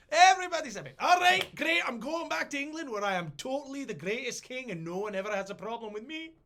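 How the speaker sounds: very high, loud, giddy voice; triumphant and smug; gleeful boast. Retold or imitated. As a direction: James leaving for England, hammed-up glee. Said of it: "King James boasting".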